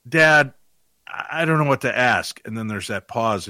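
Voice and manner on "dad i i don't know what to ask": higher, louder plea to dad; lightly voiced helpless kid; imitated daughter. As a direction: whine it a little, at a loss. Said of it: "you hear her go".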